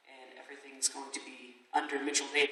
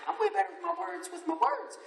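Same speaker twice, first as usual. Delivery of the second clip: high mock quote; 13 semitones higher than usual. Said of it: "I was like".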